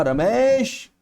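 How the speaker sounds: long high call of Ramesh; calling out loud. Shown as a demonstration. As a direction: call Ramesh from far away, high and loud. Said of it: "मैं जोर से बोलूंगा ताकि आप तक आवाज पहुंच जाए".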